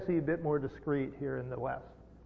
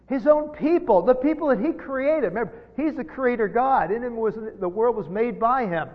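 higher and louder, driving the point home; full force preaching; 7 semitones higher, 7 dB louder than usual. Acted. Preacher pressing on Jesus' own people, full force. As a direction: preach it out with rising fervour.

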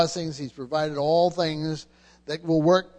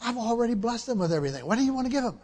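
higher, fast, soft voice of God answering; 5 semitones higher, 7 dB quieter, much faster than usual. Acted. Preacher voicing God's reply to the praying believer, lighter and soft. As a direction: speak as God, gently correcting the asker. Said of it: "And God says to us".

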